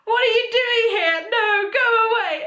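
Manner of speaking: high-pitched, hammed-up wail; mock horrified protest. Fan reliving Umbridge's return, hammed-up horrified protest. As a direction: plead in exaggerated horror.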